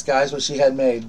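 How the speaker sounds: usual speaking voice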